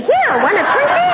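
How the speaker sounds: high, coaxing offer with a laugh; sweet bribing mom. Retold or imitated. Guilty mother coaxing her son with a Twinkie, high and sweet. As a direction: sweeten it up to make amends.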